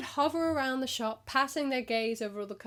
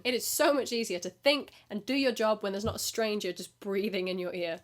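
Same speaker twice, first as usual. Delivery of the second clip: normal talking voice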